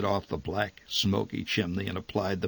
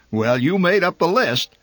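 retorting mother; 9 semitones higher, 7 dB louder than usual. A narrator's reading. Mother snaps back at father.

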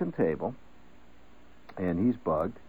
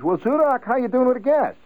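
higher, loud, friendly hail; the chemist greets Zudak at the bar; chemical worker; 14 semitones higher, 4 dB louder than usual. Retold or imitated.

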